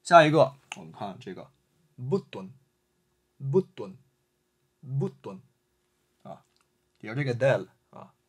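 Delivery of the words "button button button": button with a brief hold on the doubled t; teacher modelling button, short pause at the doubled t; slight mid pause. Shown as a demonstration. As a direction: say button as but-ton with a tiny stop. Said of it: "停顿一下button啊即可".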